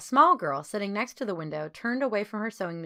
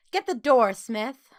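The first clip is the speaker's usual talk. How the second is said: put-on louder, sharp command to a girl; stern order; 5 semitones higher, 7 dB louder, slower than usual. A narrator's reading. Overseer ordering a girl to open the door, stern loud command. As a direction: bark it like a strict overseer. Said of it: "ordered a frowning woman".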